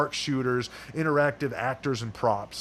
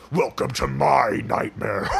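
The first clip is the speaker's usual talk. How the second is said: slow, louder menacing slasher voice; Freddy Krueger voice; 5 dB louder, slower than usual. Acted. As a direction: drawl it like a horror villain. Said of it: "our deluxe Freddy Krueger".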